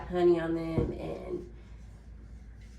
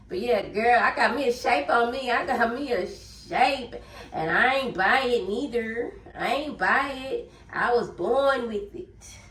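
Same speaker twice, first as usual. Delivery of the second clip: louder, higher sassy boast; sassy brag; 5 semitones higher, 5 dB louder than usual. Pretend. Bragging to viewers about her natural shape, loud and sassy. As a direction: show off your shape with attitude.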